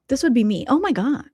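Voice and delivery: ordinary delivery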